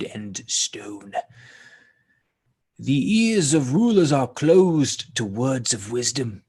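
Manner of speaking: slow, grand declamation to Egypt; scribe's solemn proclamation. A narrator's reading. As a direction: declaim it like an ancient lament.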